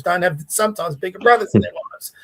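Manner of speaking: ordinary talk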